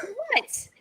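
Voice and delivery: high-pitched disbelieving "what"; retold shriek of surprise; mock shocked. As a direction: exclaim it in high, startled disbelief. Retelling reaction to shoeless friend.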